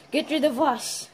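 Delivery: ordinary talk, nothing marked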